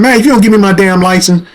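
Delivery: snapping. Retold or imitated. Driver fed up with officer's personal questions, snapping. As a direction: retell losing patience in one run. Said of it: "I just snapped".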